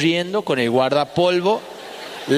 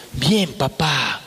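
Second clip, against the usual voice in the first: drawn-out polite little voice; rich boy's reply; 5 semitones lower, much slower than usual. Retold or imitated. Rich boy answers his father.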